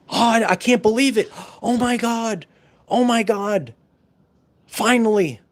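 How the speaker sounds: high, breathless disbelief; dawning joy. Retold or imitated. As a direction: gasp out joyful disbelief. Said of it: "imitated relieved people".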